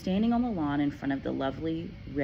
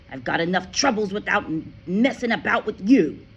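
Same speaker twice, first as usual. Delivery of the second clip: louder, exasperated scolding; irritated father; 4 semitones higher, 7 dB louder than usual. A narrator's reading. Father scolds Matilda, loud and exasperated. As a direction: swat the child away verbally.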